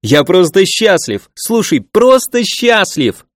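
high, slow, drawn-out happy cry; joyful exclaiming. Acted. Liang shouting his happiness in the forest, high and drawn out. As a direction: stretch it out in sheer joy. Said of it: "Ещё раз заорёшь".

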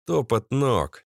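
usual speaking voice

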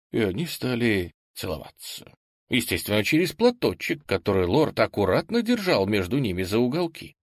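usual speaking voice

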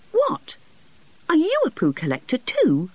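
put-on sudden high excited boy; excitedly. Geoffrey thrilled to meet another collector, excited high voice. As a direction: leap up in pitch with delight. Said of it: "asked Geoffrey excitedly".

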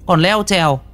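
scolding ghost woman. Acted. Possessed woman berating a child, high scolding cry.

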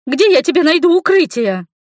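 high, loud, exasperated protest; indignant yell. A narrator's reading. Heroine told to hide mid-fight, yelling back indignantly.

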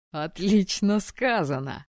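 loud, slow booming exclamation. Acted. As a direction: boom it out heartily. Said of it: "разразился громоподобным хохотом".

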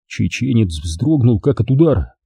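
ordinary delivery, nothing marked

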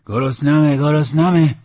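loud, high-pitched bellow; character roaring for food; hungry roar. Acted. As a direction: holler it out demandingly. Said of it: "نعره کشید".

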